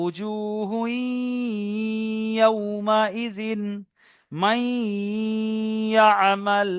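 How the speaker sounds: উজুহু ইয়াউমাইজিন, মাইয়ামাল with the nasal merge held long; slow recitation on a sustained level note; ghunna demo. Shown as a demonstration. Teacher showing one-alif ghunna, drawn-out nasal recitation. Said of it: "এখানে একালিক গণনা করছি দেখছেন".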